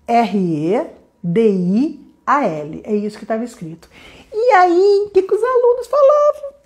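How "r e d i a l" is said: R E D I A L spelled slowly letter by letter; spelling out letters. Shown as a demonstration. Teacher spells the word for live viewers, slowly letter by letter. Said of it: "Eu vou soletrar".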